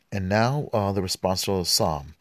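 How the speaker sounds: normal talking voice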